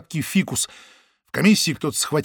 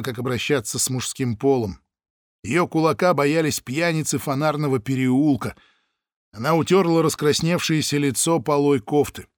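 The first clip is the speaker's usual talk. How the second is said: plain reading voice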